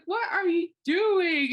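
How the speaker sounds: loud, high, drawn-out telling-off; exasperated yell, stretched and high; retold shocked scolding. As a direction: cry it out slowly at a misbehaving dog. Retold scolding of biting dog.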